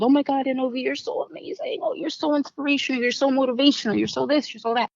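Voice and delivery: high, breathy mock gushing from admirers; gushing praise. Imitating people praising her in recovery, high gushing voice. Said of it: "being told".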